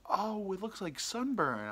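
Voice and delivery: put-on high, slow old-lady voice; imitated old lady. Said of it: "this ninety-year-old lady".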